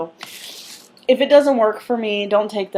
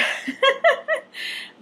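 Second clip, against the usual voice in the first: laugh. Real, not acted. Giggle mid-talk.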